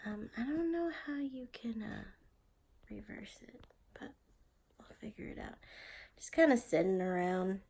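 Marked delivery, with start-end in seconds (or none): 0.0-5.6 whispering. Real, not acted.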